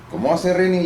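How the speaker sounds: high, incredulous retold question; mock disbelief. He asks Rosario how Reni sounds, high and baffled. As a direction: play it as a high, baffled protest. His own startled retold reply.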